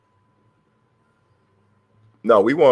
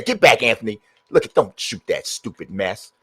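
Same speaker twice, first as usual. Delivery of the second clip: ordinary talk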